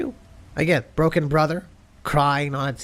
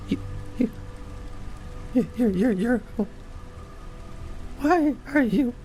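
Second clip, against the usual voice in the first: stammering nervous voice; 12 semitones higher than usual. Acted.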